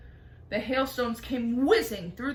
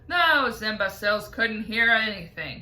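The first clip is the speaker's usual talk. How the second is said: louder mocking boast; loud jeering; 8 dB louder than usual. A narrator's reading. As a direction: centipede scoffing at the cloud men. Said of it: "he cried".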